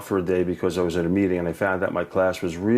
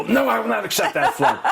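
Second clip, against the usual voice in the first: high, laughing refusal; hammed-up indignant teacher, laughing; mock protest while laughing; 12 semitones higher than usual. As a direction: refuse the flunk through laughter. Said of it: "I go".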